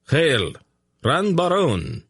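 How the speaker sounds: high, slow ringing salute; a soldiers' cheer, drawn out and high; thundering legions. A narrator's reading. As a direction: hail the new emperor like a whole legion. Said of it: "the legions thundered".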